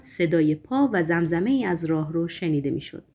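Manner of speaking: plain reading voice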